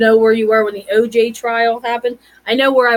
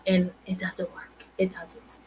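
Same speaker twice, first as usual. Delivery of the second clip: whispered and much softer; whispered aside; 4 semitones lower, 11 dB quieter than usual. Real, not acted. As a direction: confide it under your breath. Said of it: "aside to the audience".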